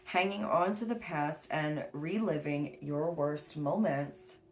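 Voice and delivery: slow, deliberate book reading; reading aloud. Acted. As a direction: read it like a passage.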